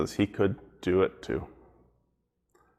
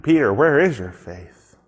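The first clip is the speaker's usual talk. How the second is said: higher, louder mock scolding; scolding Peter; 5 semitones higher, 6 dB louder than usual. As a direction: chide him like a disappointed teacher. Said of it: "give Peter a hard time".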